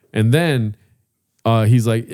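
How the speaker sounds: normal talking voice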